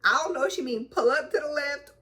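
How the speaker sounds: high, meek mock confusion; high confused self. New driver asking trainer, high confused voice. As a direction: ask up high, lost and unsure. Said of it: "So I'm like".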